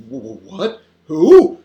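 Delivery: flustered stammer into a startled hoot; voice gone funny. A narrator's reading. Father shocked by the caller, flustered. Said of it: "his voice goes all funny".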